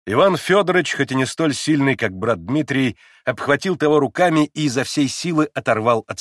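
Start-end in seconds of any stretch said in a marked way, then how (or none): none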